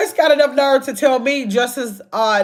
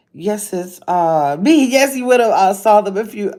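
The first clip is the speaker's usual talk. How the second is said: normal talking voice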